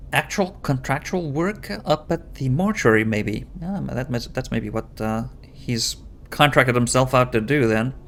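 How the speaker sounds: ordinary delivery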